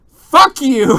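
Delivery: loud, high parting yell; hearty shout. Acted. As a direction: bellow it as a sign-off. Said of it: "end the podcast with a hearty".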